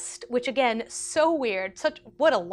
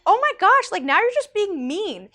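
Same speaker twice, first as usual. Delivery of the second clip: shocked protest; 8 semitones higher, 7 dB louder than usual. Retold or imitated. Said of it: "and I was like".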